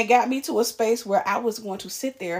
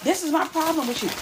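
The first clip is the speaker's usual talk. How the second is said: higher, imitating a friend lecturing; friend's lecturing voice; 6 semitones higher, faster than usual. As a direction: mimic her preachy explaining. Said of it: "So she's like".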